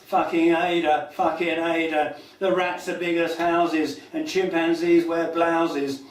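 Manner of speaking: driving, rhythmic chant-like recital; performance poetry. Acted. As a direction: punch out each short rhyming line on the beat. Performs rhythmic rhyming poem.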